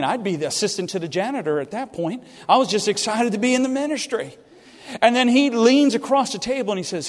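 normal talking voice

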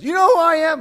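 high, full-volume outraged shout; yelling and screaming. Retold or imitated. Entitled customer yelling at the store manager, outraged. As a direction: shout it like a self-important customer. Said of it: "he started yelling and screaming".

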